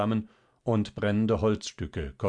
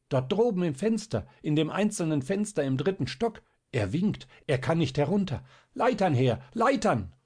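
urgent shouting; 8 semitones higher than usual. A narrator's reading. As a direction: yell it over the fire noise. Crowd shouts for ladders at fire. (laute, hohe Hilferufe)

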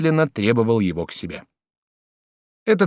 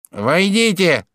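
high nasal call; nasal shout; 8 semitones higher, 5 dB louder, much slower than usual. A narrator's reading. Judge calls visitors in through door, nasal shout. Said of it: "крикнул гнусавый голос".

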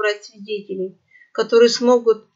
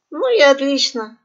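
smiling minister; 5 semitones higher, 5 dB louder than usual. A narrator's reading. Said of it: "ещё шире заулыбался министр".

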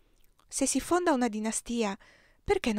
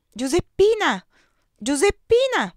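loud calling of a name, pitched higher; 10 semitones higher, 10 dB louder than usual. Retold or imitated. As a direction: call her name across the house. Papa calls the girl's name.

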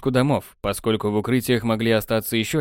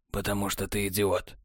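lower, much softer, cool and smug; cool technician; 3 semitones lower, 9 dB quieter than usual. A narrator's reading. Technar answering Borland, low smug and soft.

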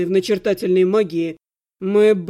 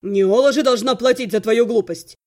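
sharp reply in a lower voice; sharp retort; 3 semitones lower, 4 dB louder than usual. A narrator's reading. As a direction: cut your sister off sharply. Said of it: "резко ответил Ирга". (резкий ответ)

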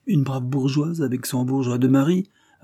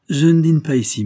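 higher, louder announcement; calling out; 6 semitones higher, 8 dB louder than usual. A narrator's reading. Priest tells servant his dinner plans, calling out. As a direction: project it to the servant.

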